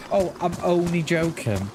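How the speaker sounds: ordinary delivery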